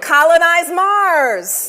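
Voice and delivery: high, loud, slow prompt; emphatic call-out. Acted. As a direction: call it out slowly to the crowd. Prompting audience call and response.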